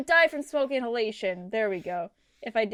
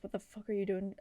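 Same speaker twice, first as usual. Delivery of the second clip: hushed inner voice, much softer; 4 semitones lower, 10 dB quieter than usual. Retold or imitated. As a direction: mutter it to yourself in disbelief. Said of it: "my brain hole goes".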